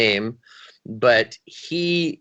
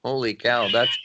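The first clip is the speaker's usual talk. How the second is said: ordinary talk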